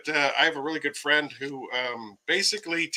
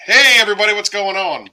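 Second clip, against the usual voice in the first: loud greeting; 7 semitones higher, 11 dB louder, faster than usual. Real, not acted. Stream host greets viewers.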